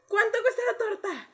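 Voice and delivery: high, whiny imitation of a caller; hammed-up pitiful question; mock tearful customer. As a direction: ask it in pretend tears. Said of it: "Pero llorando, así".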